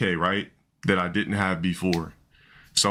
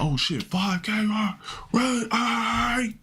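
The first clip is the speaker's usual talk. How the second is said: high squeaky mock panic voice; mock hype reaction; 12 semitones higher, much slower than usual. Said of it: "What the fuck was that?".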